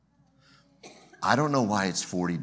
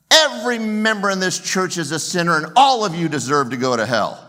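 much higher, louder hellfire warning; louder preacher thunder; 7 semitones higher, 7 dB louder than usual. Retold or imitated. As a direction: raise it to a hellfire roar. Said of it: "he looks up again and he says".

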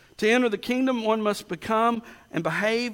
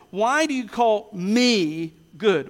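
slow question with "me" drawn out; stress on me; 3 dB louder, slower than usual. Shown as a demonstration. Preacher shows where Jesus put the emphasis, stressing "me". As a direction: lean hard on "me", then land "good". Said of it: "I think the emphasis is".

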